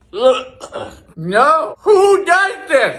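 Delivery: high, whining cry of disgust; appalled, tearful-sounding outburst; revolted wail. Real, not acted. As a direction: protest as if gagging on the taste. Revolted after tasting caviar.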